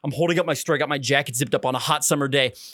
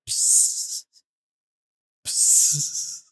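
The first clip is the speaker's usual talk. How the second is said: short hissed psst spurts; imitated pee squirts; 3 semitones lower than usual. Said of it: "his piss is sounding like".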